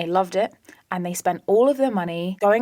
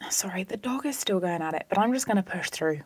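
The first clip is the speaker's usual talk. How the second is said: whispered apology about the dog; soft unvoiced whisper; 4 dB quieter than usual. Real, not acted. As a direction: hush an aside over the noise. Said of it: "whispered apology".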